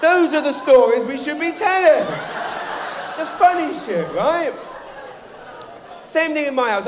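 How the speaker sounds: loud, high laughing shout over the crowd. Real, not acted. Comedian to audience, shouting through laughter. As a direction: belt it out while cracking up.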